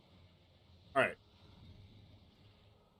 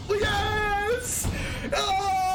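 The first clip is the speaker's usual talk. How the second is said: joyful cry; 7 semitones higher than usual. Real, not acted. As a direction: burst out in delight. Perfect card drawn, joy.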